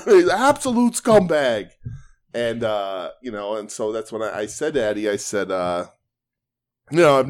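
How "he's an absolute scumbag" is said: high, loud, drawn-out put-down; laughing mock insult. Friend retelling the wrestler's insult, laughing mock denunciation. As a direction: imitate the denunciation through laughter, stretched out.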